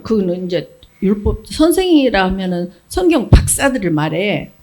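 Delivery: normal talking voice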